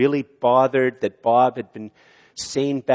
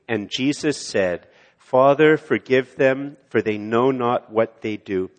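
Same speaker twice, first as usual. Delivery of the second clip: recited again with congregation; 5 dB louder than usual. Shown as a demonstration. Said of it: "Let's say it again".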